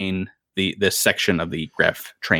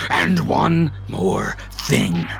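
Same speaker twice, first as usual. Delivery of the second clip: slow, higher mock villain sign-off; menacing skeletal captain; 5 semitones higher, slower than usual. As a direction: tease it like a villain.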